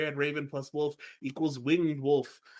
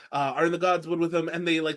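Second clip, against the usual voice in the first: ordinary talk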